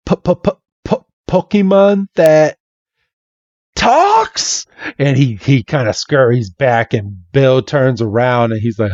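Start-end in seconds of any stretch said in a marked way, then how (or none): 0.1-4.7 stuttered start, leaping high at the end; mock astonished stammer; stammering disbelief. Red startled by a talking Pokemon, stammering.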